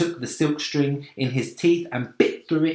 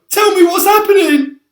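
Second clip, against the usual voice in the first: terrified wail; 13 dB louder than usual. A narrator's reading. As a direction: wail it in helpless terror. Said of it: "wailed the earthworm".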